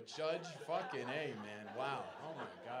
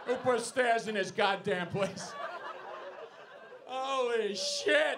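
mock complaint; 7 semitones higher, 7 dB louder, slower than usual. Real, not acted. Walking drunk lady down stairs.

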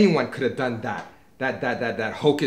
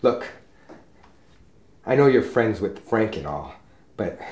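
ordinary talk

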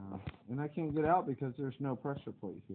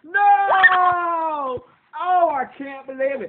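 long, high wail of defeat; dismayed scream; 18 dB louder, much slower than usual. Real, not acted. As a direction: scream at losing it all, very loud. Player falls after making the jump.

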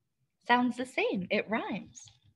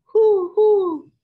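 high, loud hooting owl call; 12 semitones higher, 10 dB louder than usual. Acted. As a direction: hoot the words like an owl to the kids. Owl hoots in picture book.

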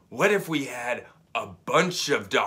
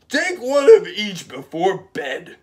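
loud, higher doctor voice; doctor's order; 6 semitones higher, 10 dB louder than usual. Acted. Doctor ordering the patient, loud and high. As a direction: bark the instructions up high.

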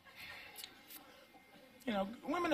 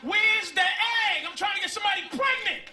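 sperm shouting; 5 semitones higher, 6 dB louder than usual. Pretend. Sperm yelling through the uterus for the egg. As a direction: bellow for the egg like an angry thug.

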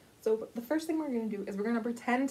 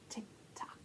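whisper; 17 dB quieter than usual. Acted. Whispered in chant.

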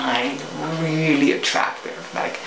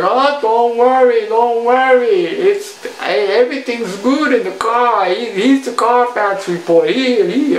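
salesman voice; 7 semitones higher, 4 dB louder than usual. Retold or imitated. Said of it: "the sales guy was like".

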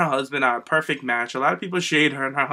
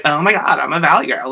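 louder, mock Valley girl voice; 7 dB louder than usual. Fan mocking the misconception of Eva Marie, Valley girl voice. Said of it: "People think that she's like".

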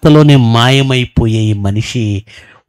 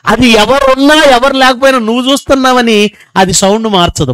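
ordinary talk

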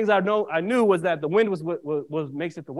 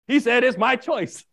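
slow, higher and louder self-justification; retold insistent defence; 5 semitones higher, 5 dB louder, slower than usual. Son explains to the cop why he left, retold insistent defence. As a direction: press the point slowly, retelling your defence.

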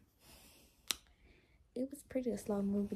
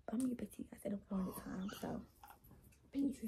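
soft late-night whisper; 9 dB quieter than usual. Real, not acted. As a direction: whisper so nobody wakes. Late-night whispered vlog update.

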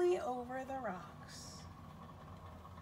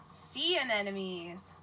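Sea anemones said slowly, syllable by syllable; slow modelled word; 5 semitones lower, slower than usual. Shown as a demonstration. Teacher modelling a word for children to repeat, slow and clear. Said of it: "Can you say that?".

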